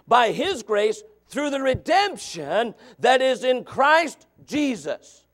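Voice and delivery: drawn out, very slow; slow, weighty pulpit emphasis; slow preaching. Real, not acted. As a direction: stretch Christ Jesus out. Preaching climax slowed.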